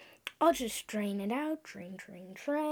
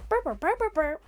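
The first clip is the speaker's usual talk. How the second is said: high beeping and burping noises, playful; machine noises; 6 semitones higher, 3 dB louder, faster than usual. Pretend. Kid making broken time machine noises. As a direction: mimic a sputtering machine, rising.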